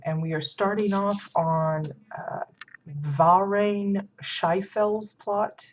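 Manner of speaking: ordinary talk, nothing marked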